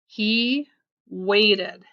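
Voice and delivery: plain reading voice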